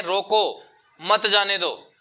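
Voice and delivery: slow रोको, a break, then मत जाने दो; pause after रोको. Shown as a demonstration. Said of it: "आप रुक कहाँ रहे हैं".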